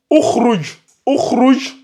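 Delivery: "ukhruj" lu avec un ou initial; lecture avec ou initial. Shown as a demonstration. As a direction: prononce "ukhruj" en commençant par le son ou. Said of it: "Ici, on va lire". ("ukhruj" articulé à l'arabe, ou au début)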